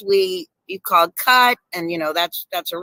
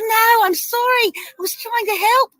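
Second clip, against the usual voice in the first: flustered apology; 13 semitones higher than usual. Retold or imitated. Olivia after spilling candle wax.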